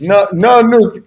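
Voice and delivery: high, drawn-out caricature dictator; slow mock decree in a high voice; hammed-up leader's refusal; Kim Jong-un. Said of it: "Kim Jong-un is like".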